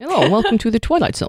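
lightly put-on Dutch accent; a hint of a Dutch accent. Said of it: "Is that a Dutch accent?".

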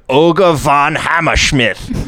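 put-on drawn-out, lightly German-accented name. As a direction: announce it slowly with a hint of German. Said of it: "decided to give him a German accent".

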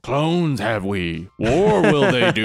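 mock Yoda. Yoda-style clone wars line.

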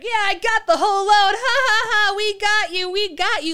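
high, loud mocking taunt; bratty kids. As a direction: crow like a gloating brat. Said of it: "Like a bunch of fucking brats".

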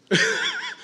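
high burst of laughter. Real, not acted. The preacher laughing at his own bit, high laugh. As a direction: break into laughter.

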